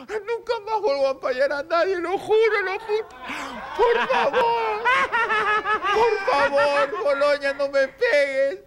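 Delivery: high, fast, frantic begging; pleading in fear. Acted. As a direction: plead for mercy, half laughing. Begging the footballer not to hit.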